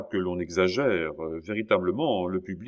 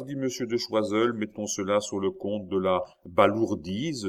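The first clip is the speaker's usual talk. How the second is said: ordinary delivery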